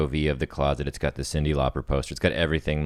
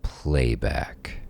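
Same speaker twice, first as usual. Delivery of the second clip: measured, dramatic playback read; script narration; 3 dB louder than usual. Acted. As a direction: read the screenplay aloud with weight and pauses.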